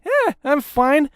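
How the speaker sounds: imitating an oblivious, carefree thought; carefree shrug. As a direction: slowly shrug it off as if all is well. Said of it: "just driving around in my car thinking".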